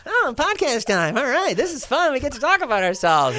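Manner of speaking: high, sing-song fake glee; mock excited. Mocking himself to David, high and gushing. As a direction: gush like a kid on a treat. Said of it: "And I'd be like".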